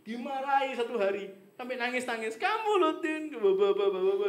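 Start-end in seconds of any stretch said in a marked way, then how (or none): 2.4-4.3 loud, high retold scolding from an old friend; mock scolding. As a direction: mimic a friend scolding you loudly and fast. Friend scolding her in story.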